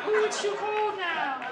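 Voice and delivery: high, whiny mock complaint; high mocking whine about the cold; mocking girlfriend voice. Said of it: "she has a nerve to say to me".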